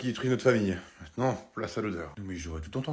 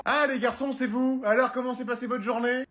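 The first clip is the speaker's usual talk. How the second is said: a mother's voice pitched higher, cheerful; 8 semitones higher than usual. Acted. Mum welcoming the boys home, in a high, cheerful mother voice. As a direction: mum greeting her son and his friend, high and sweet.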